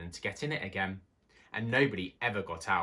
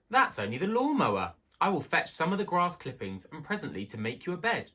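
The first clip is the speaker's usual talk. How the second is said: high, light mouse voice calming a friend; reassuring little mouse; 7 semitones higher, 3 dB louder than usual. A narrator's reading. Timmy reassuring Johnny.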